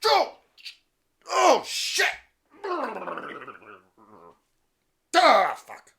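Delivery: high, loud startled yelps; hammed-up startled cry; mock startled old man. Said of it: "and he goes".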